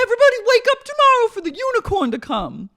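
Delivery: high, loud, rapid holler; mock ranting yell. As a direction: shout a fast, over-the-top mock announcement. Continuing imitation of shouted rant.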